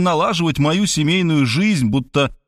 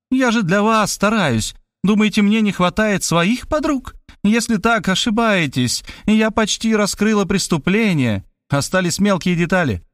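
a hint of a composed, knowing woman; cool woman detective; 7 semitones higher than usual. A narrator's reading. Detective woman coolly warning the writer.

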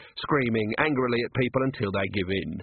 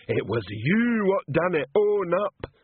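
slow, much higher bellowing troll; troll shouting; 7 semitones higher, slower than usual. Acted. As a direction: shout it at the lined-up staff. Troll accusing maid.

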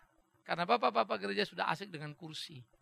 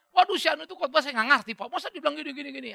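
complaining churchgoer; 8 semitones higher, 10 dB louder, faster than usual. Retold or imitated. Congregant complaining about a sermon.

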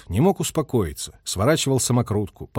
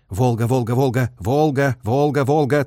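radio operator's repeated chant; rhythmic, clearly spoken signalman's call; distinct call-sign calling. A narrator's reading. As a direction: enunciate the call sign insistently. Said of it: "торопливо и отчётливо выговаривал позывные".

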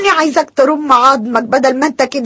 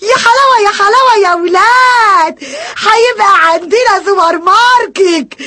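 delighted cry; 5 semitones higher than usual. Acted. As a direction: savor the good news aloud. Wife delighted by supermarket plan.

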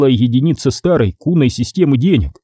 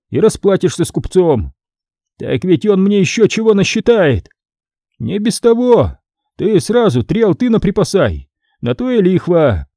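threatening scribe; 6 semitones higher than usual. A narrator's reading. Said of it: "Ответил он Ваське с угрозой".

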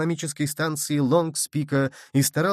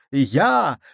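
loud, blunt single word; roared reply; 5 dB louder than usual. A narrator's reading. Nicholl answers Michel's challenge, loud roared reply. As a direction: fire it straight back with force. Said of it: "проревел в ответ Николь".

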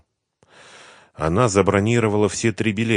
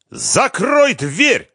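much higher, louder, slow drawn-out yell; yelling with all his might; 7 dB louder, much slower than usual. Acted. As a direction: bellow at the door. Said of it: "заорал изо всех сил".

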